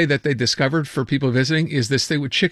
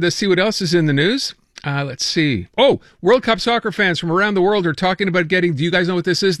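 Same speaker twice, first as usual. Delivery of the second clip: ordinary delivery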